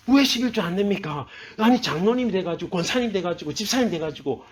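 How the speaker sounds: mock indignant pastor's complaint; angry clergy scolding. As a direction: scold church members, starting high and loud. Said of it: "아마 제사장들이 화를 냈을".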